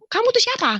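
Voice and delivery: high-pitched challenge; retold exasperated question. Woman asking the voice who it is, exasperated. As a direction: act out demanding an identity, pitched high.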